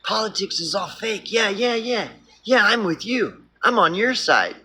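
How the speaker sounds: lightly hammed-up fox flattery; fake-friendly fox. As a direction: coo along like a false friend. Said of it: "The wolf isn't gonna be smiling and saying".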